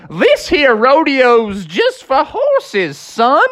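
a gruff rodeo clerk turning them away; cowboy drawl. Acted. Clerk tells Froggy rodeo is for horses, slow Texan drawl.

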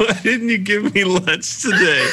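put-on high-pitched wounded little kid; mock-pitiful kid voice; sad child. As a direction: imitate a forlorn child, on the edge of laughing. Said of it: "just looking so sad and saying".

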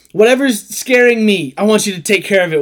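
hammed-up damsel pleading with boyfriend; damsel in distress. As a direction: play the helpless girlfriend begging her knight. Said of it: "Yeah, like".

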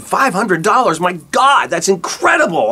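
high-pitched, thrilled disbelief; re-enacted giddy astonishment; amazed excitement. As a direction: blurt it out as if stunned by a windfall. Acts out his amazed reply.

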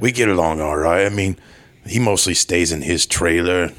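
put-on British action-star accent; Jason Statham. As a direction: talk like a laid-back British tough guy. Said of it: "how was his accent".

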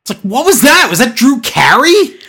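high-pitched, incredulous questioning; baffled outburst. Acted. Viewer caught off guard by the announcer, baffled. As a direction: jump up in pitch, startled and confused.

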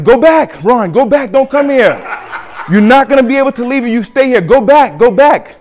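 high, loud pleading shout; loud frantic plea to go back; urgent calling. Retold or imitated. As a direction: call him back from far away, desperate. Dead friends calling from hell.

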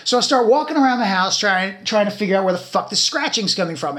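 usual speaking voice

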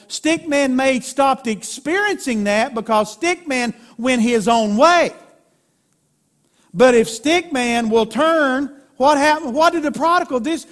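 high, forceful preacher delivery; fervent preaching. Real, not acted. Preaches at raised intensity.

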